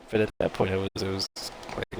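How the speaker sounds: usual speaking voice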